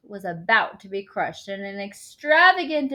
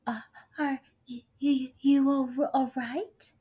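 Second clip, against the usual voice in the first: shy; 6 semitones higher than usual. A narrator's reading. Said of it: "she asked shyly".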